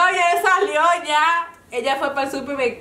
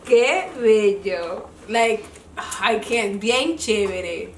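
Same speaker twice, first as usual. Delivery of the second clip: slow mock gush; 4 semitones lower, much slower than usual. Mom's phone talk imitated.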